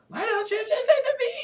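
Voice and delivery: fast, very high squeal; shrill angry whine; angry scream. A narrator's reading. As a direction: screech it in frustration, trailing into a wail. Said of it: "I let out an angry scream".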